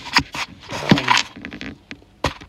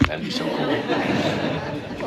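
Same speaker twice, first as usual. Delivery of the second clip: laughing wistfully; much slower than usual. Real, not acted. Jokes about his name.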